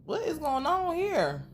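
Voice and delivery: mock scolding. Talking to stranger in bed.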